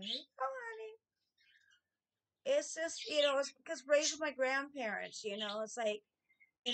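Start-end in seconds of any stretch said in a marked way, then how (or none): 0.0-0.9 sweet greeting. Retold or imitated. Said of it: "And I'm like".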